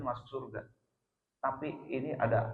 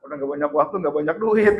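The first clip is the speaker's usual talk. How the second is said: mock wifely grumble, loud and raised; complaining; 3 semitones higher, 10 dB louder, faster than usual. Wife to husband about time and money, mock complaining. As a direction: pile up grievances like an imitated nagging wife. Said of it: "Tadinya ngeluh".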